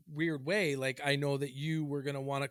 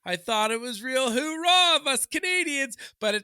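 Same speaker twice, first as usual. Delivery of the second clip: loud, high-pitched fed-up rant; pissed off; 10 semitones higher, 7 dB louder than usual. Real, not acted. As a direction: rail at it, shouting. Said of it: "starting to piss me the fuck off".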